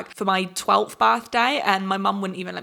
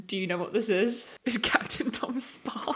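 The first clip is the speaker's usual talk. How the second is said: whisper; 6 dB quieter than usual. Real, not acted. Whispered reveal.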